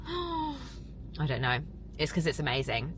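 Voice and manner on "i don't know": high, soft, slow, teary gasp; emotional gasp. Real, not acted. Wondering aloud why she is emotional, soft teary gasp. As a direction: catch her breath, overwhelmed. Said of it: "Why am I getting emotional?".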